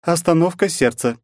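high flat doll voice. Acted. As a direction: report like an AI doll. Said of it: "голос куклы в моём наушнике".

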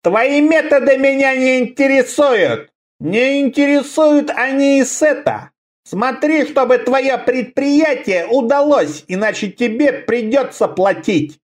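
terrible high ringing voice; high, ringing, furious and commanding; ringing crystal chime voice. Acted. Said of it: "страшный высокий звенящий голос".

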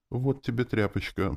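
high, gentle coo; tender cooing. A narrator's reading. She hands the patient a cloth, cooing tenderly. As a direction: fuss over the patient sweetly. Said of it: "проворковала она нежно".

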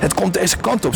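high, tense warning; somewhat tense. A narrator's reading. Free Electron warns the group of approaching lights, somewhat tense. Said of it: "zegt Free Electron enigszins gespannen".

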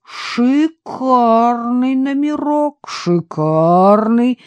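slow, drawn-out breathy rumble; booming. A narrator's reading. Vadim admiring the posh hotel room, drawn-out booming. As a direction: savor each word, impressed. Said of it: "гудел Вадим".

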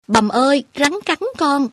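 mock whine of a child faking a snakebite; a retold whimpering call to mother, pitch jumping high; whimpering cry. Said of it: "mẹ mày mếu máo kêu".

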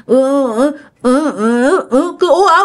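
high mock moans, rising to a sob; imitated moaning. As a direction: groan like someone talking in a nightmare. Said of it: "ông cứ".

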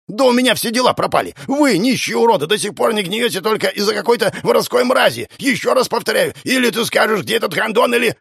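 high-pitched furious rant; near-screeching rage. A narrator's reading. Enraged man threatening the sister at the door, screeching. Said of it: "Он едва ли не визжал".

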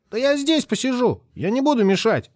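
put-on high, wheedling child; high whiny kid refusing to leave; pleading boy. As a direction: beg like a stubborn little boy.

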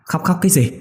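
put-on old man snaps at the crying niece on the phone; snapping irritably. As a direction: cut her off, demand answers. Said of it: "Ông Toàn gắt lên".

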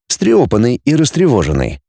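plain reading voice

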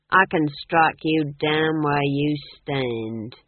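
slow, loud cold threat; threatening captain. Acted. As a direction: lean into the threat slowly. Leader threatens Granny.

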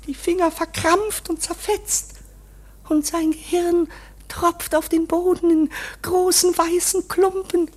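ordinary talk, nothing marked

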